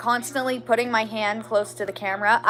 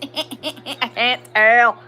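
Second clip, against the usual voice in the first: laughing; much slower than usual. Real, not acted. Laughing at the clock.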